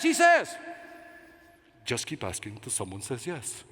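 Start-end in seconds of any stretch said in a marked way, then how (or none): 1.8-3.6 put-on low, soft Spanish-accented advice; Ricardo Montalban voice. Mentor revealing the secret to success in a low Spanish accent. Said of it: "in that Ricardo Montalban voice".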